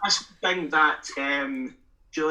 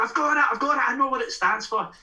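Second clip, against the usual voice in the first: excited fan; 10 semitones higher than usual. Retold or imitated. Fan running up after the gig, high eager guess. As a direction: blurt it out like a fan who cracked the code.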